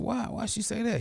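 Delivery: high, soft question; concerned surprise. Retold or imitated. Mentor asking his student why, high and concerned.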